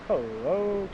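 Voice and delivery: ordinary delivery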